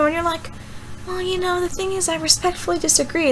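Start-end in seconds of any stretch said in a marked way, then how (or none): 1.1-3.3 soft, measured, respectful reply; calm disagreement. Retold or imitated. Answering someone who is attacking you, calm and respectful. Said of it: "calmly display the facts".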